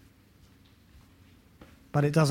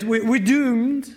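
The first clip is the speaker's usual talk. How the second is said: drawn-out, higher Scottish doom cry; Scottish accent; 6 semitones higher, 4 dB louder, slower than usual. Retold or imitated. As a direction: imitate a doom-laden Scottish sitcom character. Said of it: "in his Scottish accent".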